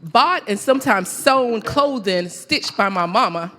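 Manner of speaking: loud, emphatic live poetry recital with measured pauses; spoken-word poem. Acted. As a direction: declaim it line by line, letting each phrase land.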